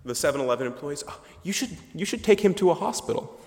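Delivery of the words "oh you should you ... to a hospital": mock helpful advice. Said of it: "offering helpful advice".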